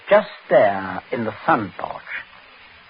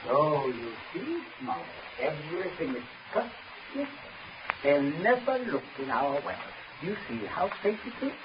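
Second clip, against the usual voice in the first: soft gloating; 12 dB quieter than usual. Acted. Prince softly gloats to Smollett about the well. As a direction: purr it with quiet satisfaction.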